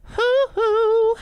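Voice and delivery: sharp falsetto whoop in tribute. Retold or imitated. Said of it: "we're doing another [name] bit".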